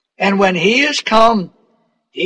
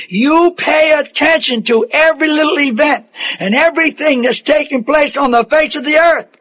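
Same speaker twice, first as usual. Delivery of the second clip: higher, insistent preacher's warning; fervent preaching; 4 semitones higher than usual. Acted. Preacher warning the congregation against deceivers, fervent and higher. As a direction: drive the warning home to the crowd.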